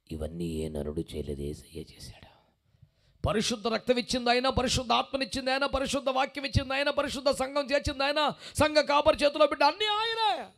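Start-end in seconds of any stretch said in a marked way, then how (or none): 3.3-10.5 building louder, higher preaching to a peak; rising fervent preaching. Acted. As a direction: preach it up to a shout.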